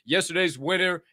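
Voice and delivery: ordinary talk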